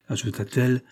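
ordinary talk, nothing marked

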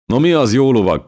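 high, loud teasing question; teasing challenge. A narrator's reading. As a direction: call out a playful challenge to a dance partner. Borbála ugratva kérdezi táncpartnerét. (incselkedő, hangos kérdés)

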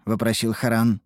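ordinary delivery

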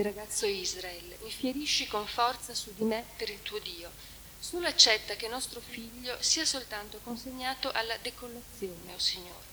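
a mournful recitation of the Trento lament; solemn reading of a lament. Acted. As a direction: read it like a mourning poem. Reads the lament translation aloud.

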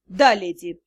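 low, meek demon's reply. Acted. Demon humbly agreeing to his lady, low. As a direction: submissive servant's answer. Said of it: "Покорно согласился демон".

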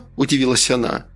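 usual speaking voice

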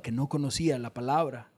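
ordinary talk, nothing marked

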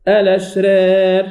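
الأشرار recited slowly, final alif leaning toward e; imala in recitation. Shown as a demonstration. Teacher recites examples of imala. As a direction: draw out الأشرار with the alif tilted to e. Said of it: "كذلك".

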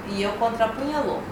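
ordinary talk, nothing marked

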